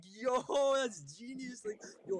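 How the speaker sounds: high, slow, cracking up mid-line; laughing quote. Retold or imitated. Clerk behind the counter loves the bong name, high slow laughing. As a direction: voice the clerk losing it over the name.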